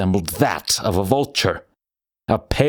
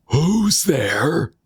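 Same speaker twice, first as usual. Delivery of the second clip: startled cry; 9 semitones higher than usual. A narrator's reading. Old man in bed calling out in fear, startled and high. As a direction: cry out as if jolted awake. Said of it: "crying out".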